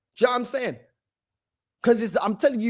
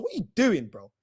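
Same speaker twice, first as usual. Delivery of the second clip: normal talking voice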